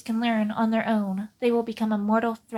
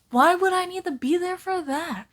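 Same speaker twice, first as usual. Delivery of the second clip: high character voice; 8 semitones higher, 4 dB louder than usual. Acted. Character voice in comic reading.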